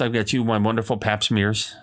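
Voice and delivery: normal talking voice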